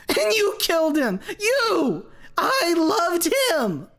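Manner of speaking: high, loud, mad shriek; wild scream. A narrator's reading. Basil screaming at Lucy over his dead son Leo. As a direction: scream in madness and despair. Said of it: "burst of high-pitched laughter".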